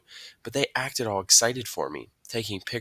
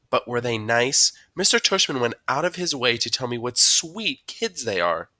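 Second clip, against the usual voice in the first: higher, louder mom voice, insistent; probing mom; 5 semitones higher, 5 dB louder than usual. A narrator's reading. Mom presses son about the kids, probing. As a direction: play a mom pressing her child for the truth.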